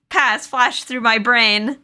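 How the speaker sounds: ordinary delivery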